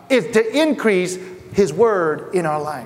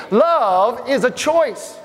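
ordinary delivery

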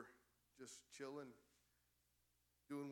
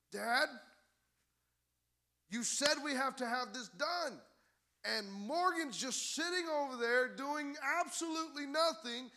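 louder, higher, tattling to dad; whiny complaining daughter; 10 semitones higher, 12 dB louder than usual. Retold or imitated. Daughter tattling to dad about her idle sister, louder and higher. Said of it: "Reagan's coming to me and saying".

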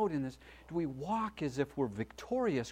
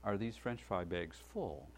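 polite quiet question; 5 semitones lower, 9 dB quieter than usual. Retold or imitated. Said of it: "Excuse me".

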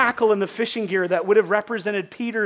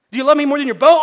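loud mock question; 5 semitones higher, 11 dB louder, much faster than usual. Jesus voiced in easy exchange.

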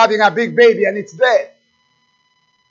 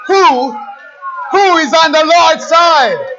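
high, loud rallying cry; full-force preaching; 7 semitones higher, 5 dB louder than usual. Real, not acted. Preacher rousing the church to cast the devil out, full-force preaching. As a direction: thunder it out like a revival preacher.

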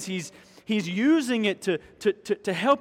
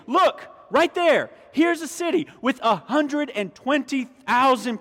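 raised pitch, pointing out each fact; emphatic urging; 4 semitones higher, 4 dB louder than usual. Retold or imitated. God shows Jonah the huge city, emphatic urging. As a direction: urge him to look, hammering key words.

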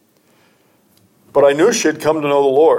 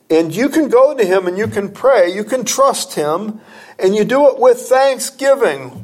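higher, rising pulpit fervour; preaching; 8 semitones higher than usual. Acted. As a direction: lift the congregation with earnest conviction. Preacher builds to raised delivery.

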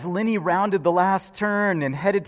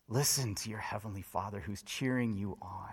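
whisper; 8 semitones lower, 13 dB quieter than usual. Real, not acted. Intimate appeal to the congregation.

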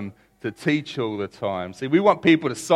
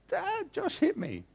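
put-on high, soft, slow little-boy whine; child tattling; 10 dB quieter, slower than usual. Young son running up to dad to tattle, high whiny child voice. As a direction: play a small kid telling on his brother.

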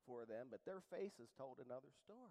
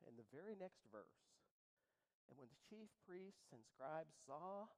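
ordinary talk

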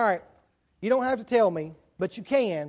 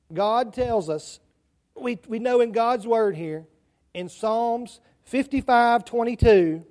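ordinary delivery

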